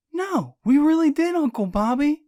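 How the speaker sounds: high, loud insisting; insistent protest. Acted. Niece insists to her doubting uncle, high and urgent.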